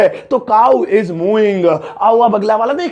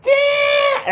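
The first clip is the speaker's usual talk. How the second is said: sharp, very high screech; eagle screech imitation. Shown as a demonstration. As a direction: screech like a hawk. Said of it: "ऐसे ऐसे करती है". (चील जैसी तीखी, ऊँची चीख)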